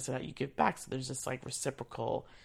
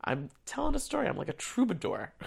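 playful mock boast, quick and higher; joking; 6 semitones higher than usual. Joking to colleagues in the Congress office, playful mock boast. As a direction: tease herself as a grand troubadour. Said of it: "joking a little bit".

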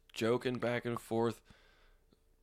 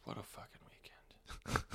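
whispering; 13 dB quieter than usual. Real, not acted. Exhausted whisper after weekend.

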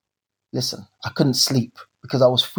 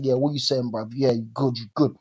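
put-on low, softer London street accent; 5 semitones lower, 5 dB quieter than usual. Trying to pass with strangers at the station, put-on London accent. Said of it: "trying to put on a London accent".

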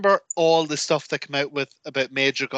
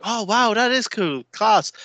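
higher-pitched imitated delight; impressed girlfriend; 7 semitones higher, slower than usual. Girlfriend watching a cool wrestling stunt, impressed. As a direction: mimic someone pleasantly wowed. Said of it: "And she'd be like".

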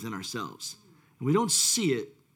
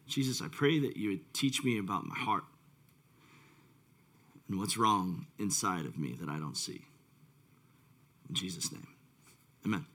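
quiet prayer; 7 semitones lower, 7 dB quieter than usual. Real, not acted. Leads a short prayer.

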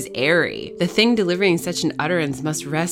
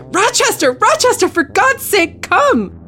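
very high, loud frantic cries; high-pitched, urgent plea at full volume; desperate screaming; 9 dB louder than usual. Acted. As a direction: scream his name as if your life depends on it. Victim screams for help through wall.

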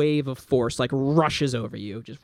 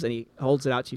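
ordinary delivery

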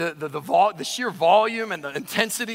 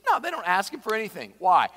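normal talking voice

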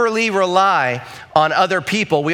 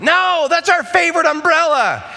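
put-on high, whiny child's protest; whiny kid protest; 11 semitones higher than usual. Kids in the car protesting to dad, high and whiny. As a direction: mimic the kids clinging to their umbrella. Kids fighting over umbrella.

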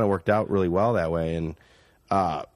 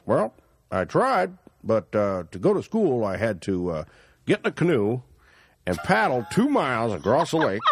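put-on backwoods Canadian accent. Driver explains getting to school, backwoods Canadian accent. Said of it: "kind of doing his accent".

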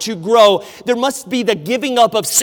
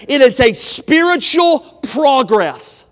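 loud, slow, higher shout leaning on spiritual; preached shout; 5 semitones higher, 8 dB louder than usual. Real, not acted. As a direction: thunder out "spiritual progress" from the pulpit. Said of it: "Growing as the saint is this".

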